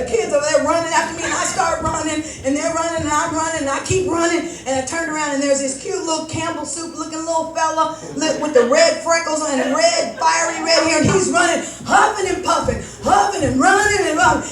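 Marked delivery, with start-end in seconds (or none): none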